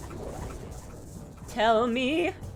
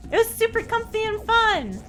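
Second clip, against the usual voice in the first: high squeaky chat voice; cute anime streamer; 10 semitones higher, 6 dB louder than usual. Acted. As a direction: squeal it out happily. Reading chat reply in high voice.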